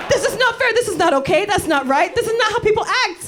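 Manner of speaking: put-on high, emphatic Dutch-accented rebuke; teacher-like yelling in a Dutch accent; scolding Dutch accent. Imitating husband lecturing a stranger, scolding in Dutch accent. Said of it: "That's a Dutch accent".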